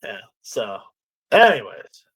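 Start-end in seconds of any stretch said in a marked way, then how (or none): none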